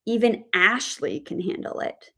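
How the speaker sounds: ASHLEY punched mid-sentence; stress on ASHLEY. Shown as a demonstration. Teacher shows stress on the name, stress on ASHLEY. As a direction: land the weight on ASHLEY. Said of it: "put the word stress on the name Ashley".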